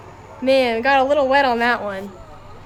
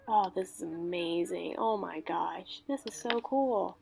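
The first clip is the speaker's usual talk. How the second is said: soft, awed murmur; hushed awe; 4 semitones lower, 12 dB quieter than usual. Real, not acted. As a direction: breathe it out in wonder. Watching fireworks in awe.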